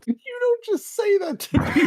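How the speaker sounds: very high-pitched protest; shocked indignation. Real, not acted. Player objecting to being called not straight, high indignant squeal. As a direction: squeal it in disbelief. Indignant reaction to character's remark.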